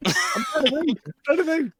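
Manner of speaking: high-pitched pretend protest; hammed-up high excuse; mock protest. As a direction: plead your case in a silly high voice. Acted protest at being scolded.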